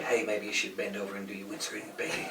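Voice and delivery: low, soft, leering voice with a laugh; sleazy come-on.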